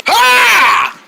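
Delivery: sudden high loud shriek; ambush scream. Pretend. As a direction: leap out with a scream.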